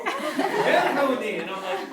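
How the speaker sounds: high, loud, drawn-out laugh; the words dragged out by laughter; laughing through words. Real, not acted. Retelling the honey mistake to the audience, laughing. Laughing while telling story.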